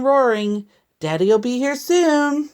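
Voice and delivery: usual speaking voice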